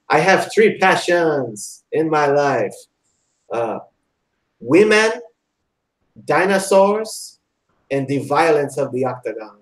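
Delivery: a hammed-up French accent lingering on each passion; GSP French accent. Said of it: "'cause he has a French accent".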